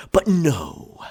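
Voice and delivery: indignant outburst. Acted.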